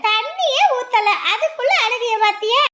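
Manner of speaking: very high, slow cooing; drawn-out high singsong; baby talk. Acted. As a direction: coo teasingly at a crying baby. Teasing crying baby before bath.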